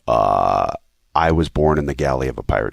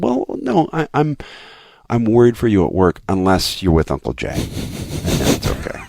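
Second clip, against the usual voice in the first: daughter's voice; 6 semitones higher than usual. Retold or imitated. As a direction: voice a small child explaining. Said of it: "She said".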